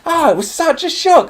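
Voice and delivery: very high, hammed-up gasping amazement; mock shock. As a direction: play everyone's fake shock way up high. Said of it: "We were all so surprised".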